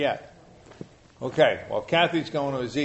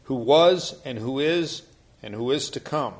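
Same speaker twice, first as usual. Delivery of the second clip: loud, solemn Bible reading; scripture reading; 2 semitones higher, 7 dB louder than usual. Acted. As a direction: proclaim it like a preacher. Reads Revelation aloud to group.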